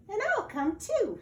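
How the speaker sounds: a tiny eager mouse. Acted. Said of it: "said Mouse".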